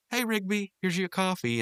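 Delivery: very high-pitched imitated female voice; Eileen. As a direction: call to him in a put-on high voice. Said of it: "She goes".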